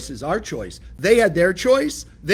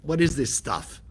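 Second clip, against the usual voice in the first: scoffing skeptic; 4 dB quieter than usual. Retold or imitated. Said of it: "that's why people read the Bible".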